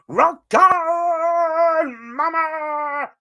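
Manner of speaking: loud, high hammed-up catchphrase greeting; YouTuber catchphrase impression. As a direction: belt it like his signature opener. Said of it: "he used to start with".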